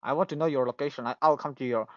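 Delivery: ordinary talk, nothing marked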